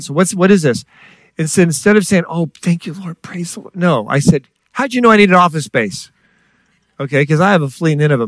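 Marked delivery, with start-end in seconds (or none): 2.3-3.8 higher, light thankful praise; imitated grateful relief; mock gratitude. Mimics gratitude he failed to show. 4.7-6.1 high, accusing question; retold indignant challenge, pitched up; suspicious tone. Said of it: "my secretary hears my voice tone".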